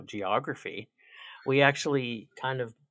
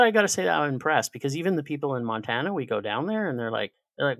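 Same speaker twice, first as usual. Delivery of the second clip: usual speaking voice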